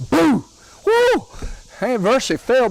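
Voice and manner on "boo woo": much higher, loud prankster holler; husband jumping out of the corn crib, loud high holler; hollered boo. Retold or imitated. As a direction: leap out and yell to scare her. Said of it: "jumped out and hollered".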